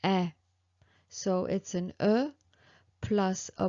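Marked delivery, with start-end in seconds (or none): 0.0-0.3 "eh" as an open French è, on its own; accent grave sound. Shown as a demonstration. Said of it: "this one is".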